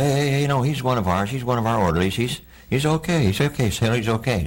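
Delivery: calm him down fast; urgent reassurance. Retold or imitated. Someone calms him down.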